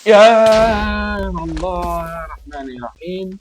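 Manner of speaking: very slow, drawn-out, high and loud cry to God; exasperated plea. Real, not acted. Gamer begging God over bad luck, drawn-out wail. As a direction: stretch every word out in exasperation.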